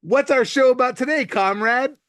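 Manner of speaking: Southern-accented pretend Russian stooge; mock Southern accent. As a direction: play a comrade with a hammed-up Southern accent. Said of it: "gave that a southern accent".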